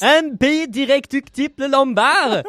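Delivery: loud, high caricature German shouting; German accent. Acted. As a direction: bellow slowly in a mock German accent. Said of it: "le dire avec l'accent allemand". (accent allemand parodique, crié fort)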